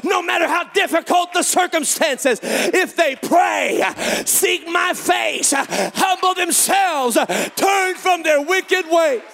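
shouted, high-pitched preaching with slow, stretched phrases; fiery revival preaching; full-force preaching. Acted. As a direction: preach it at the top of your lungs to the congregation.

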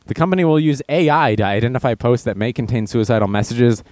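normal talking voice

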